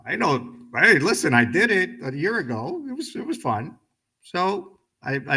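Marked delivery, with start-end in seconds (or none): 0.1-3.8 eager. Retold or imitated. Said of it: "I went".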